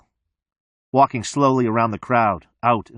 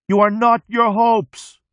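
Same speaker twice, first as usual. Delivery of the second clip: high, slow, loud scream; 11 semitones higher, 4 dB louder, slower than usual. A narrator's reading. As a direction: scream it into the headlights. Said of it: "cranes forward into the glare and noise and screams".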